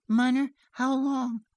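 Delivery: put-on high child's question; anxious little girl. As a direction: ask mother in a small child's high voice. Child asks mother anxiously.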